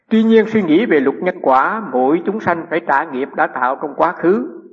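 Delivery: slow, emphatic reading of a teaching; deliberate scripture-style delivery, drawn out; formal reading. Acted. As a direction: read the passage out slowly, projecting the opening. Reads teaching from the text.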